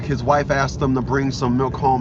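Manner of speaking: ordinary delivery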